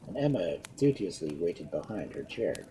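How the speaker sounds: usual speaking voice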